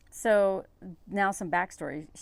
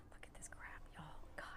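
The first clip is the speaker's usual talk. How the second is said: conspiratorial whisper to the audience; whispered aside; 22 dB quieter than usual. Real, not acted. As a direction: lean in and whisper it. Sarcastic aside to viewers.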